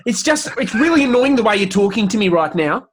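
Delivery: high, loud Australian telling-off; Australian shouting. Acted. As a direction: shout at someone in a put-on Australian accent. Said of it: "if an Australian shouts in at someone".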